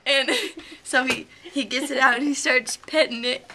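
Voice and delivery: usual speaking voice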